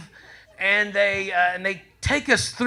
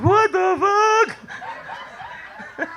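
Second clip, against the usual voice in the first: shocked yell; 13 semitones higher than usual. Retold or imitated. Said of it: "He was like".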